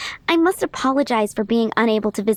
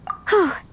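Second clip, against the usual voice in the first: relieved sigh; 6 semitones higher than usual. Acted. Relieved that help is coming.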